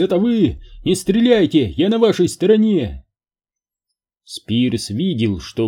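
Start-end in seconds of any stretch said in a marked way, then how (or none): 0.0-3.0 loud, high pleading call; shouting. Acted. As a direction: call out desperately. Man calls out to soldiers.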